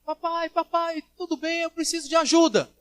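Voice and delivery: high-pitched imitation of a girl pleading; high girlish plea for help; little girl's voice. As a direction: put on a child's voice calling to daddy. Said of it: "Uma voz de uma menina".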